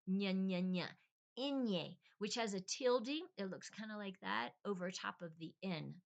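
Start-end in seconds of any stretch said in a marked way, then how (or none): 0.1-2.0 nya repeated with a palatal ny, then inye; Spanish ñ drill. Shown as a demonstration. Demonstrating the ñ to learners, nya and inye with palatal ny. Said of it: "after the N is the inye".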